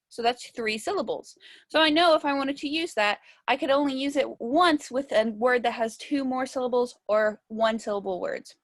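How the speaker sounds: ordinary delivery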